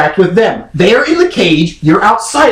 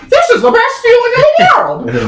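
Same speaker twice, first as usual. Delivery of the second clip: 1 excited dog voice. Retold or imitated. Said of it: "you're like".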